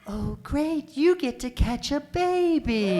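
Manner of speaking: high, slow, vacant midwife; mock the midwife's blissfully oblivious drawl; frontal lobotomy voice. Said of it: "in her frontal lobotomy voice".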